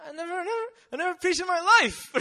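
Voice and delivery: high-pitched, loud mock panic; hammed-up incredulous outburst; panicked protest. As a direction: squeal it in disbelief, half laughing. Acting out his panicked reply.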